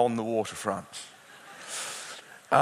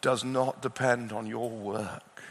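a slow, lower, gently voiced God; God speaking; 4 semitones lower, 5 dB quieter than usual. Preacher voicing God's words to a precious child, slow and tender. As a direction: lightly voice God, slowing on each assurance. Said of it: "And the Lord our God says".

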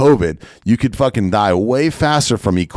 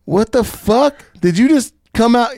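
mock outraged yell, pitched way up; outraged Kevin Hart; 4 dB louder than usual. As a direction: stretch it out high in shocked disgust. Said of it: "And Kevin Hart goes".

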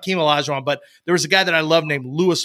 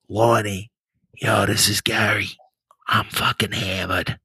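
put-on lower, thick Boston accent, drunk; 6 semitones lower than usual. Gary leaving a drunk voicemail for Lonnie, lower, thick Boston accent. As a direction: slur it like a hammered Boston guy on a voicemail. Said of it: "this thick Boston".

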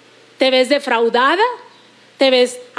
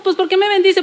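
ordinary talk, nothing marked

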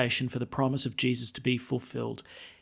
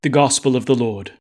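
solemn proclamation, louder; liturgical acclamation; 2 semitones lower, 7 dB louder than usual. Acted. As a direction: close the gospel solemnly.

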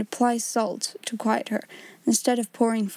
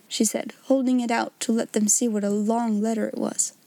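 usual speaking voice

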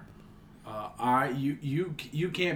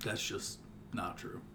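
whispered; 6 semitones lower, 14 dB quieter than usual. Acted. Flat denial.

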